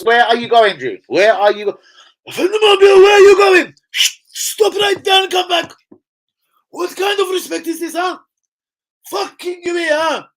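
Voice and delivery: shrieking high mock shout after someone leaving; mock outraged shout. Calling a friend back who just left the stream, high mock shout. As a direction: yell after him in a hammed-up high shriek.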